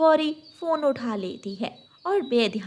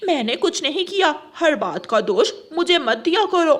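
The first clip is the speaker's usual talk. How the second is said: higher, louder defensive retort; indignant denial; 5 semitones higher, 6 dB louder than usual. Acted. Wife rejecting husband's blame, indignant retort. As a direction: push back hard against the blame.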